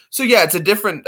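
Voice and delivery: ordinary delivery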